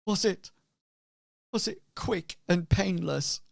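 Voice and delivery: halting, high-pitched plea; grieving innkeeper. Acted. Darth asking Hazra how she died, grieving. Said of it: "Darth turns to you and says".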